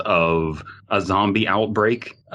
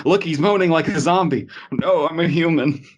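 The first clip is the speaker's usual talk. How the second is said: high panicked voices; 9 semitones higher than usual. Pretend. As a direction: play the scared cop, high. Acted cop and bystander.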